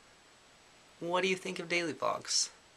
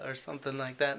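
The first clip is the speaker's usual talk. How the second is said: usual speaking voice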